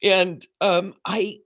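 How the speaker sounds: ordinary talk, nothing marked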